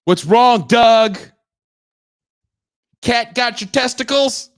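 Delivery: loud, high mock heckle; yelled taunt. Viewer berating a tame commentator, yelled taunt. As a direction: bellow it like a jeering fight fan. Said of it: "You yell at him".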